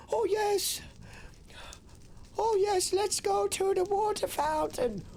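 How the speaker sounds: high, slow mock posh voice; posh rich kid. Mocking rich private-school kids, hammed-up posh voice. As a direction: mimic a spoiled rich kid, drawn out. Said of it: "so you call it".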